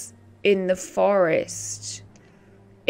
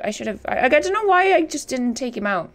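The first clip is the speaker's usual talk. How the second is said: ordinary talk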